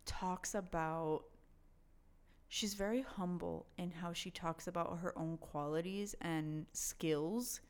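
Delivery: usual speaking voice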